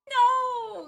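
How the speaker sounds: high, loud mock-despairing no; playful wail. Real, not acted. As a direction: protest like a crushed fan. Refusing to play Amber.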